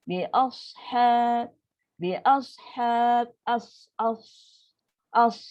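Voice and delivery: bi ashab ending with air let out on the as; slow bi ashab, breath escaping on the sad; airy release. Shown as a demonstration. Teacher demonstrating breath on the sad. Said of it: "Lepaskan angin tu".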